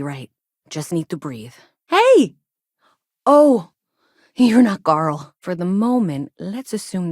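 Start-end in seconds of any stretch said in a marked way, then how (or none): 1.9-5.3 loud, high startled "Hey!" that drops into surprise; startled shout. Acted. Greeting a stranger she mistook for Carl, startled shout. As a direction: jump at someone, then realise your mistake.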